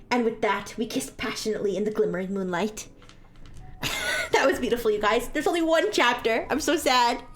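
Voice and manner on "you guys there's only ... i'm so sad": plain reading voice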